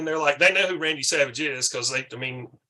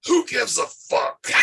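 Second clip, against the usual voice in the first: slow, higher gravelly-free macho growl imitation; Randy Savage; 7 semitones higher, slower than usual. Randy Savage at the diner snapping at cheering staff, slow mock macho voice. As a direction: drag it out like a hangry wrestler. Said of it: "Randy Savage goes".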